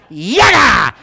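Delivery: loud, high yell on impact; imitated scream. Man hit in the face by a hawk, loud yell. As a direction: cry out as if struck. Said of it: "hit me in the face so hard".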